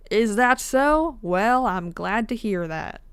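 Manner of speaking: a hammed-up Southern storekeeper; Southern accent. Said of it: "This is not a Southern accent".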